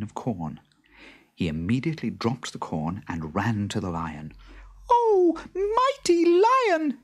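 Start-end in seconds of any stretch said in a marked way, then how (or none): 4.9-7.0 very high, tiny squeaking mouse voice; squeaky mouse. A narrator's reading. Little mouse pleads with the trapped lion, high squeak. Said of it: "he squeaked".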